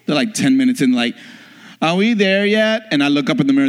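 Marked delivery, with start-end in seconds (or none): 1.8-2.9 put-on drawn-out childish whine, higher; whiny kid. Preacher imitating his kids in the car, slow whine.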